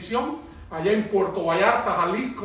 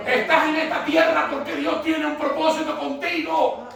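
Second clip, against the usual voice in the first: raised, high preacher's exhortation; preaching shout; 6 semitones higher than usual. Acted. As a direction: lift it and proclaim it to the church.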